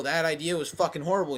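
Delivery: ordinary delivery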